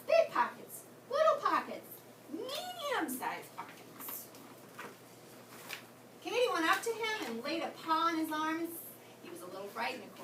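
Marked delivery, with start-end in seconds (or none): 2.3-4.2 slow and drawn out. A narrator's reading. Narrator drags out phrase.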